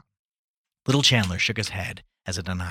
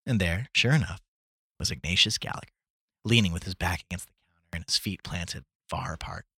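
ordinary delivery

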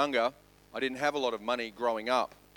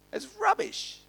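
scornful dismissal. Real, not acted. Dismissing love of possessions.

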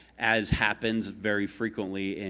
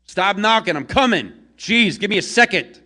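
much higher and louder, yelling through the door; angry shout from inside; 12 semitones higher, 13 dB louder than usual. Acted. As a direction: bark it out, annoyed at being woken. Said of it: "the voice from inside".

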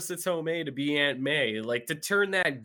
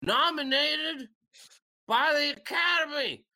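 put-on drunken braggart; 9 semitones higher, slower than usual. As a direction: boom it out like a high wrestler bragging. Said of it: "And he's like".

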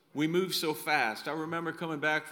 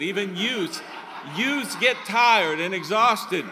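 laughing; 3 semitones higher, 8 dB louder than usual. Real, not acted. Laughing at tired twenty-somethings.

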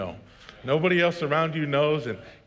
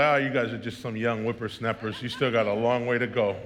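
mock old-timer; 7 semitones lower than usual. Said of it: "older generations will be like".

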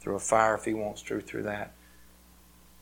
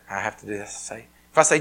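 ordinary talk, nothing marked

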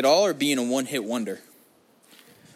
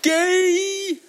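sudden, way higher and louder jeering yell; mock shout; 6 dB louder than usual. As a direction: blurt it to tease yourself. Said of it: "when someone just goes".

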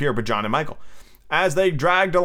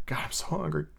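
much softer, mock-pitiful undertone; miserable aside; 11 dB quieter than usual. Boys privately admitting hunger behind Peter's back, miserable. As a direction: drop to a small hungry murmur. Boys' aside after faking being fed.

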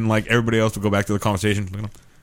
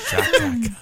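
high falsetto squeal on "[name]"; slower than usual. Pretend. Friends riffing on a song name, high falsetto. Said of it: "gayer version of [name]".

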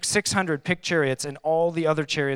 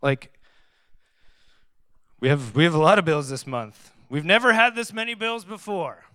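worried; 3 semitones higher, 6 dB louder than usual. Retold or imitated. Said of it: "I start worrying about".

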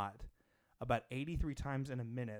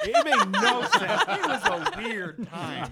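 laughing; 14 dB louder, slower than usual. Real, not acted. Laughing through the story.

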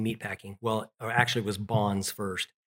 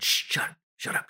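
urgent hush. Retold or imitated. Brother hushing him urgently.

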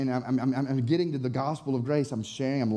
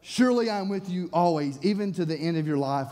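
normal talking voice

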